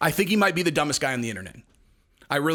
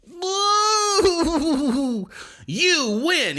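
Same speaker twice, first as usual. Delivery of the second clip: high, drawn-out mock whoop; mock cheering; 12 semitones higher, 3 dB louder, much slower than usual. Announcing the fighter's win by disqualification, mock cheering.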